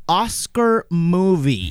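Oscar movie stretched slowly; drawn out. Shown as a demonstration. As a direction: sag Oscar movie gedehnt und langsam. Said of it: "La considero una".

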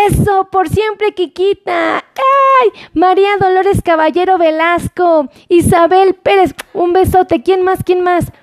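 high-pitched, celebratory roll call; excited cheering. Real, not acted. Host cheers the viewers who wrote the number, excited. Cheering contest winners by name.

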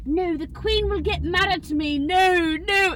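high and loud imitation of a British accent; refusing in a hammed-up British accent; mock British accent. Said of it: "putting on the British accent".

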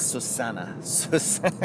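imitating a friend's accent, softly; playful put-on accent on the name, hushed; Mario's accent. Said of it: "when you do the accent".

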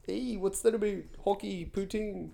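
soft put-on Canadian chat; hammed-up Canadian; mock Canadian accent. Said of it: "Isn't Minnesota close to Canada".

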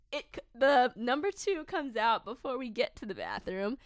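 ordinary talk

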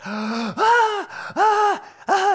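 high mock screams between gasps; loud, high screaming imitation; imitated toddler scream. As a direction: gasp and shriek like a terrified little kid. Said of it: "he starts screaming at the top of his lungs".

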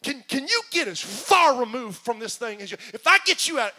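from hushed to loud plea; pleading God, building. Acted.

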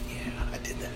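very soft, whispery brag; smug mock whisper. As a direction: quietly take the credit, half to yourself. Silently taking credit for door held.

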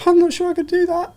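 a hammed-up nervous kid, voice climbing high; half-comic squeak of self-doubt; squeaky doubtful. Retelling his scared teenage self.

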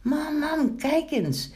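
put-on high, delighted child calling to mum; joyful calling out. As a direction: cry out the happy discovery. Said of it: "hoorde Pinkeltje opeens roepen".

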